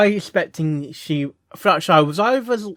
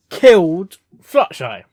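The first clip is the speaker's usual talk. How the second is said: ordinary talk, nothing marked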